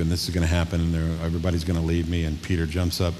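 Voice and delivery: ordinary talk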